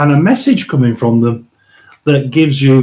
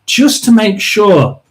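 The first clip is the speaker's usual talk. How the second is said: normal talking voice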